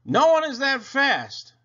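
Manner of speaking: lightly voiced smug brother; insistent boy. A narrator's reading. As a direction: hammer the point home.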